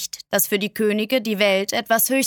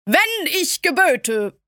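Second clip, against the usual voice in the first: slow, higher, grand pronouncement; a monarch lecturing on his power; pompous king; 7 semitones higher, slower than usual. A narrator's reading. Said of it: "pflegte er zu sagen".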